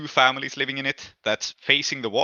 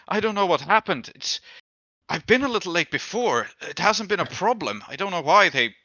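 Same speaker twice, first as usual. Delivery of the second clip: a hint of a rattled, bitter drunk man, mostly higher; nervous drunk explaining who hurt him; lightly voiced beaten drunk; 5 semitones higher than usual. GM voices Mophis again.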